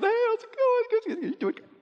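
very high, sing-song mock churchgoer; high, syrupy mock greeting; fake-cheery greeting. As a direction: parody a forced happy hello. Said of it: "you walk through going".

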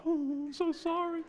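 put-on high, soft little-girl apology; imitating his daughter. Father imitating his apologetic daughter, high and soft. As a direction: mimic a sweet meek girl. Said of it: "she'd melt".

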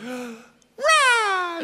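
high, drawn-out squealing roar; little cub roar. Acted. Young Simba showing off for Nala, high puny roar. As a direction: imitate a lion cub trying to roar. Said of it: "he goes".

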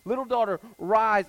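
loud, high proclamation; rousing loud call; ringing command. Acted. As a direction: call her up from death. Jesus raises the girl, preached.

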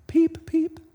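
very high tiny peeping chick; duckling peep. Acted. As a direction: squeak it like a baby bird. Baby duckling voice in story.

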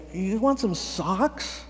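slow, drawn-out question rising at the end; silly, awkward offer. Retold or imitated. Volunteer awkwardly offering socks to a stranger, slow and silly. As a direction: stretch out the offer, sounding a bit silly. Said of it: "kind of silly".

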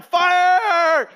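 imitating a preacher's sudden, high, loud shout; shouting fire. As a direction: belt out "Fire!" as the preacher praying over him did. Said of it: "he does it a third time".

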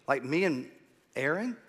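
ordinary talk, nothing marked

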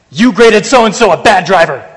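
loud, high, accusing app voice; preacher voices the survey app scolding him, loud and mock-stern; mock app verdict. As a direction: announce the verdict like a scolding machine.

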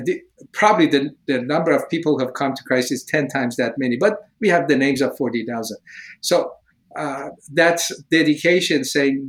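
ordinary talk

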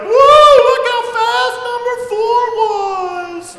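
very high, loud, slow sung-out woo; hammed-up crowd praising the anchor; crowd cheering. Crowd gushing over the anchor runner, hammed-up cheer. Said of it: "Everyone's like".

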